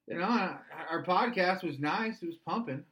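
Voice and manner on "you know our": normal talking voice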